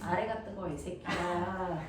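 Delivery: soft, low, drawn-out rebuke; low scolding. Real, not acted. Teacher to student who answered wrong, quiet exasperated scolding. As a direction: correct them under your breath, slowing on the insult.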